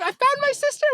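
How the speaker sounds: put-on very high, squeaky British accent; hammed-up high-pitched British voice; British girl's accent. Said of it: "She's like".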